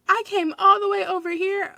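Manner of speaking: high mock-tearful complaint; imitated cracking voice. Upset customer complaining to store worker, imitated cracking voice. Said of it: "I hear her voice cracking".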